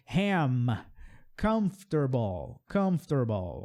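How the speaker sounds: comfortable slowed, three syllables kuhmf-ter-bl; slow model word. Shown as a demonstration. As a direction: break comfortable into three beats. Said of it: "pronunciazinha difícil".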